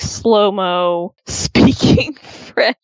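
mock slow motion, words stretched out; slowed-down speech. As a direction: imitate someone speaking in exaggerated slow-mo. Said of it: "then he slows down".

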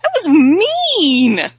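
high, loud, drawn-out protest; mock outrage. Real, not acted. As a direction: scold with long, indignant vowels. Scolding co-host in mock outrage.

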